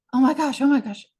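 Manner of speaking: mock the shaken man remembering a ritual; hint of rising alarm, hammed lightly; freaking out. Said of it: "he's, like, freaking".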